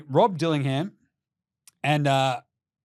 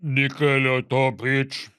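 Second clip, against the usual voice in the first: slow, oddball voice imitation; wild voice impression; 3 semitones lower, 4 dB louder, slower than usual. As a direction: imitate a very weird talker. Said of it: "He's got the wildest voice".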